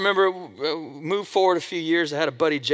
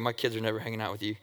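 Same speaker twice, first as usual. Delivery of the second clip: quick, much softer mock disapproval; mock disapproving parent; 7 semitones lower, 11 dB quieter, faster than usual. A listener's parent judging him, a quick soft mock disapproval. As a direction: toss it off as a judgy parent.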